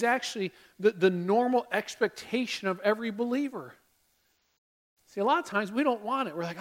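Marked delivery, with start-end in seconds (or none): none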